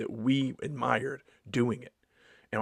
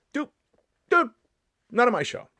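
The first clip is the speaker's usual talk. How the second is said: firm refusal; 12 semitones higher, 6 dB louder than usual. Real, not acted. Firmly refusing heckling.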